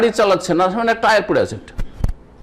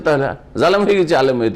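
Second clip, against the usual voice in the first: normal talking voice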